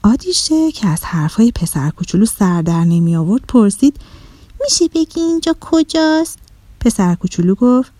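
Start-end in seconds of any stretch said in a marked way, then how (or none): 4.6-6.4 put-on high little-girl voice, asking; puzzled little girl. A narrator's reading. Lost girl asking the boy about the island, puzzled. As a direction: ask in a high, puzzled child's voice. Confused girl asks where she is.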